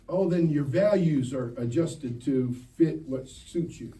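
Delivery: ordinary talk, nothing marked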